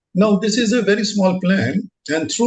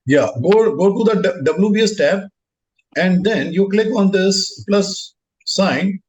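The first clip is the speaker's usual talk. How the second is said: normal talking voice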